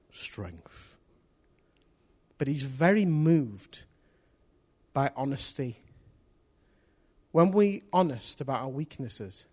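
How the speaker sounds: ordinary delivery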